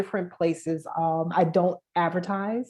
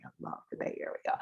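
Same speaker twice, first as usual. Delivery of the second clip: whispering; 11 dB quieter, faster than usual. Real, not acted. Aside affection for Bay Area.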